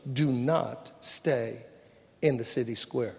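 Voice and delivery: low, slow, quiet warning; firm insistence. Retold or imitated. Lot insisting to the two angels, grave firm warning. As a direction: weigh each word, low and deliberate.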